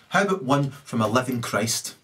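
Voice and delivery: ordinary talk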